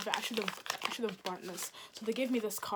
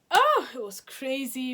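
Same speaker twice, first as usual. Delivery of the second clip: slow, much louder and higher; excited exclaim; 4 semitones higher, 10 dB louder, slower than usual. Real, not acted. Telling viewers how wild the day was, loud and drawn out. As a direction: stretch out the oh with real excitement. Wrapping up the story.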